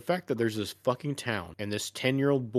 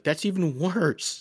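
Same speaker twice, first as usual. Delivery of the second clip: slow, higher, exaggerated dismay; mock horror; 8 semitones higher, 4 dB louder, slower than usual. Trucker slowly, in mock horror, learning it's a puppy. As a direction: drag it out in appalled disbelief. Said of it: "And the dude freaks out more".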